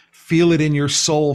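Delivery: normal talking voice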